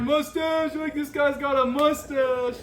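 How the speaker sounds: put-on high, slow drunken holler across the hall; drunk yelling. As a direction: ham up a wasted guy calling out to a stranger. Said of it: "just yelling at this guy".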